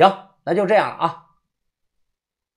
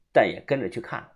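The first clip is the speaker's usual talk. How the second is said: ordinary delivery, nothing marked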